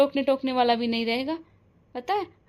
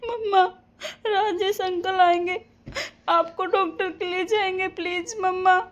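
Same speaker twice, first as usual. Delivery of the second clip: crying and pleading; 4 semitones higher than usual. Acted. A daughter sobbing and begging her unconscious mother to wake up.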